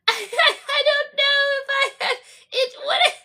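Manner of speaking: high, stammering hammed-up worry; mock anxious character. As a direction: imitate her fretting in a high voice. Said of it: "And she's like".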